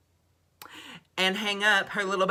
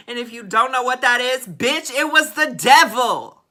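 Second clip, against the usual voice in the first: higher and louder, dramatic punchline; loud emphatic reveal; 7 semitones higher, 11 dB louder than usual. Real, not acted. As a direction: hit it hard, it was the devil. Revealing the devil emblem.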